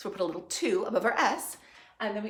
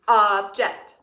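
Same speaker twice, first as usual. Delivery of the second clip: pounding the word; 10 dB louder than usual. Shown as a demonstration. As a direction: Object said loud and punched, syllable by syllable. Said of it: "Pound with me, please".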